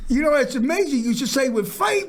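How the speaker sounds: ordinary talk, nothing marked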